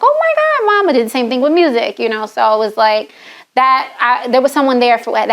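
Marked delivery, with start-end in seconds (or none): 0.0-0.9 high-pitched mock fangirl squeal; hammed-up starstruck awe; imitating starstruck staff. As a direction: imitate an amazed crowd, way up high. Voices staff seeing a celebrity arrive.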